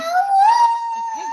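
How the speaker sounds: very high, loud, long howl; coyote howl. Pretend. Teacher reminding child how coyotes howl, loud high howl. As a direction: throw your head into a high sustained howl.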